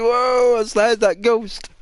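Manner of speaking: high mock gasp of a neighbour; high, hammed-up startled onlooker. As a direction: react as if a strange noise just rang out. Neighbours react to toilet noise.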